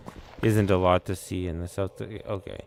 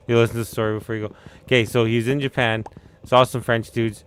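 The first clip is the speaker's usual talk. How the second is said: ordinary talk, nothing marked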